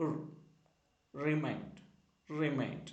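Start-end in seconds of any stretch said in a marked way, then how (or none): 0.1-0.2 "റ്" as a short rolled "ru"; letter sound r. Shown as a demonstration. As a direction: say "റ്" as the sound of R, not "ar". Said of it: "എന്ന സൗണ്ടാണ്".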